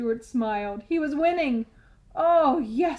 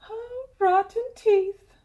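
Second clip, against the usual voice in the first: exasperated fairy; 9 semitones higher, 4 dB louder, slower than usual. A narrator's reading. Tooth Fairy sighs.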